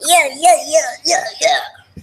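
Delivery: high, loud yeah-yeahs; mock high voice. Shown as a demonstration. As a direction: squeak out rapid high yeahs. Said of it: "it sound like".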